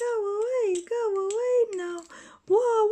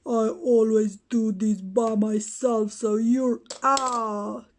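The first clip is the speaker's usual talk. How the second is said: much lower, gruff tough-guy boasting; toy Batman voice; 8 semitones lower than usual. Pretend. Batman taunting the other toys, deep boastful voice. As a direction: play the bully hero claiming his spot.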